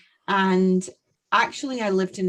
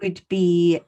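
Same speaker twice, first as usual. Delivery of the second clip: usual speaking voice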